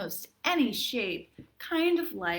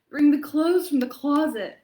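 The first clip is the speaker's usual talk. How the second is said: hammed-up reading of a sniffly poem; milk every sneeze for the kids; theatrical read-aloud, louder as the cold grows; dramatic poem reading; 3 semitones higher, 6 dB louder than usual. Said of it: "lends itself to a pretty dramatic reading".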